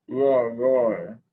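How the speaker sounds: loud playful dinosaur roar. Pretend. Child reading a dinosaur book aloud, loud playful roar.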